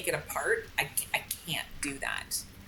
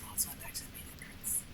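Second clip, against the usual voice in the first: hushed aside to himself, barely voiced; whisper; 15 dB quieter than usual. Real, not acted. Gamer whispering as a character walks off. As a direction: whisper it to yourself while watching. Muttering to himself while playing.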